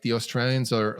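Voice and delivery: ordinary delivery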